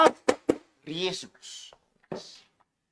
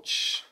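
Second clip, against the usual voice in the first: shushing; 11 dB quieter than usual. Real, not acted. Teacher hushes noisy class.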